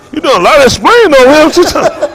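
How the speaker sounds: mock heckle. Mock heckling audience member.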